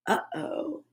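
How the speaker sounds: breathless whispered uh-oh. A narrator's reading. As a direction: drop to a scared whisper. Suspense whisper.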